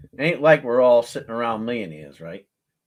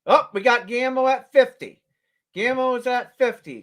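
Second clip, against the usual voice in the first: higher, louder, excited bid call; excited announcement; 9 semitones higher, 4 dB louder than usual. Real, not acted. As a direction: announce the new bid with a thrill.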